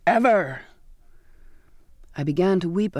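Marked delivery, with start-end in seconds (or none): 0.0-0.6 emphatic angry shout; one forceful burst. A narrator's reading. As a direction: shout it as a final ban. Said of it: "he shouted".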